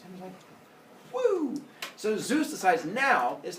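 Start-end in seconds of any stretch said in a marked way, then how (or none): 1.1-1.6 high-pitched cheer; gleeful "woo". Retold or imitated. Epimetheus praised by Zeus, gleeful cheer. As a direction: let out a high little whoop.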